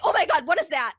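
high, loud startled yelp; mock alarmed doctor. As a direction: jump back and shout it in shock. Doctor startled by vibrating table.

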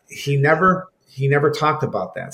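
usual speaking voice

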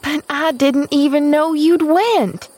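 high, agitated protest; high-pitched servant's outcry; crying out. A narrator's reading. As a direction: exclaim in flustered disbelief. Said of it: "cried Nancy".

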